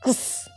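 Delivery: क्स as a soft breathed k-s with no vowel; ending sound demo. Shown as a demonstration. Teacher isolates the ending sound for pupils, soft k-s. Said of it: "इसकी sound आती है".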